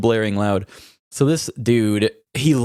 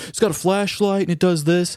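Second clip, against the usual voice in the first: much higher, smug mock voice; mock boastful weirdo; 8 semitones higher than usual. Weird coworker bragging about his phone, high mock voice. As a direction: show off the phone like a clueless oddball.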